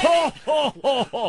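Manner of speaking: a shouted jokey Santa laugh; mock Santa shout. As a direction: bellow it like a fake Santa. Mock Santa again, louder.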